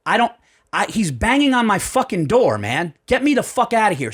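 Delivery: frantic demand. Retold or imitated. Panicked demand to police.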